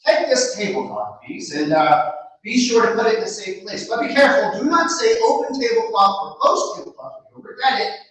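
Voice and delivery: a naive lad handing over his treasure; Antonio's voice. Acted. Antonio voiced, higher and louder.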